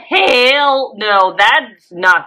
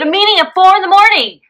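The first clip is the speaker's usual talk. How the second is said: excited; 10 semitones higher than usual. Real, not acted. Emphatic praise, raised pitch.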